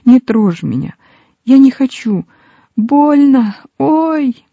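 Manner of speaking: rising high, pained begging; tearful acted cry of pain; sobbing plea. A narrator's reading. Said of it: "а потім ридала і просилася".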